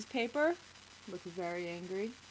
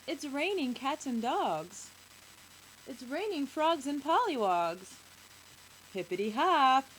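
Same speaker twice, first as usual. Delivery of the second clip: put-on high, gleeful child, building louder; excited little boy; 5 semitones higher, 7 dB louder than usual. A narrator's reading. Little boy delighting in the rain, excited. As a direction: sing out with a small boy's glee. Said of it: "The little boy says".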